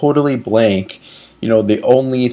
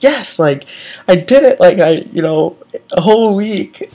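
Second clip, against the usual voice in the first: excited cheer; 7 semitones higher, 4 dB louder than usual. Retold or imitated. Self-quote, cheering a good week.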